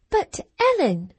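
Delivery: put-on high, astonished girlish appeal; astonished cry. A narrator's reading. Cathy appeals to Ellen, high astonished cry. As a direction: cry out in disbelief. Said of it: "cried she".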